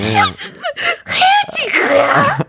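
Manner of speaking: high, slow sobbing wail; crying out. Retold or imitated. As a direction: wail it like a breakup sob. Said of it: "그래서 계속 울었거든요".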